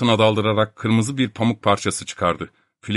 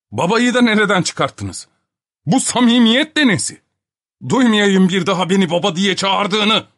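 higher, sharp indignant reproach; exasperated outburst; 8 semitones higher, 3 dB louder than usual. Acted. Professor scolding the man for calling him father, indignant. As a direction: bristle at being called father. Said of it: "çileden çıkarmaya yetti".

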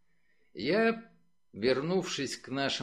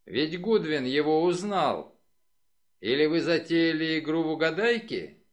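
disgusted challenge, raised in pitch and level; 4 semitones higher, 5 dB louder than usual. A narrator's reading. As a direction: throw the accusation out with distaste. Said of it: "с отвращением заявил Стив Амсел". (с отвращением, напористо)

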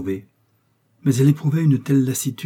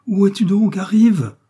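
loud calling; 11 semitones higher, 8 dB louder than usual. Acted. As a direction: shout it out, much higher and louder. Charles calls wife from below. (appel crié, bien plus haut et plus fort)

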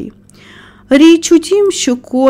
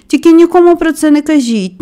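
higher-pitched firm instruction; firm request; 6 semitones higher, 4 dB louder than usual. A narrator's reading. Young master ordering the gardener to secrecy, firm. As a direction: caution the listener, "нікому" leading the phrase.

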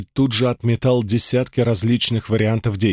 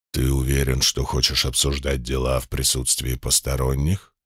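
insinuating sinister voice; 9 semitones lower, 4 dB quieter than usual. Acted. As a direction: purr it low like a sinister giant demon. Demon boss voice held.

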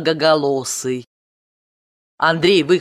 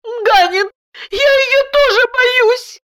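very high whimpering wife; whiny, tearful; 4 dB louder than usual. A narrator's reading. Frightened wife admits fear to her husband, tearfully. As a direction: whine it tearfully, pitched right up. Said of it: "плаксиво сказала".

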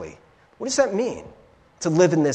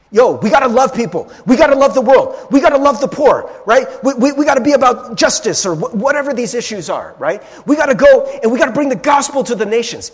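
louder, higher, pushy churchy drive; zealous activist; 7 semitones higher, 7 dB louder than usual. Retold or imitated. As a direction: rattle off duties like an eager do-gooder.

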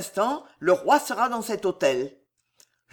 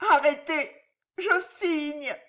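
high, strangled surrender; agonised gasp; 7 semitones higher than usual. A narrator's reading. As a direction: gasp it out, weakening at the end. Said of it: "râla la martyre".

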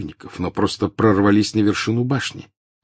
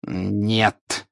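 sharp refusal; 2 semitones higher than usual. A narrator's reading. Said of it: "резко осадил".

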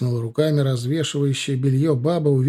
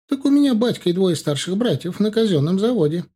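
put-on higher-pitched boy putting on importance; self-important; 6 semitones higher, 3 dB louder than usual. A narrator's reading. Boy boasting to the officer about his family's factory work, self-important. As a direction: answer proudly, pitched up. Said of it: "ответил тот важно".

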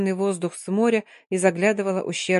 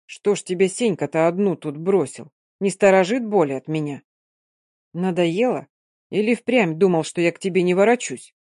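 ordinary delivery

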